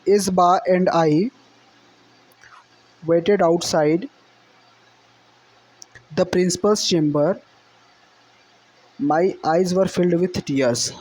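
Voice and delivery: slow, deliberate reading aloud of the English passage; slow text reading. Shown as a demonstration. Teacher reading the textbook passage to students, slow and deliberate. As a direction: read each phrase out slowly for the class.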